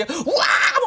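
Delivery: a real shout of joy at a late goal; wild cheer, high and loud. Retold or imitated. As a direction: yell "우와!" like a fan as the striker breaks through.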